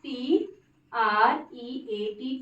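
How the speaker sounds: teacher spelling R E A T; slow spelling. Shown as a demonstration. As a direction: spell R E A T very slowly. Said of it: "treat".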